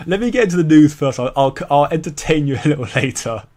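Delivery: amused laugh deferring the impression. Real, not acted. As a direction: chuckle through the words and put it off till later.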